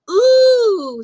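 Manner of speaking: a whole class impressed; admiring ooh. A narrator's reading. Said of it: "said the class".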